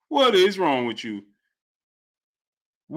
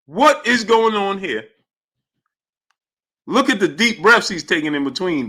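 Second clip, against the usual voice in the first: normal talking voice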